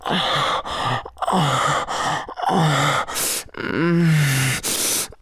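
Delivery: repeated panting "oh", then hummed moan; breathy moans. Acted. Lover getting close, breathy repeated moans. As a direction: moan in short breaths, ending on a hum. Moaning during intimate roleplay.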